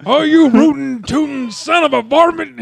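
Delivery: high, loud hammy cowboy twang; old prospector. Acted. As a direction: yell it like a grizzled old-timer. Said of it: "like a cowboy saddling up".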